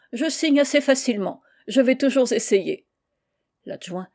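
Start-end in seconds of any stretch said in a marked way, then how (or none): 0.1-2.9 general laughing. Acted.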